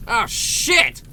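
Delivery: loud, high-pitched startled curse; sudden yelp of alarm; alarmed outburst. Real, not acted. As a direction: curse as danger suddenly appears. Sudden danger in the game.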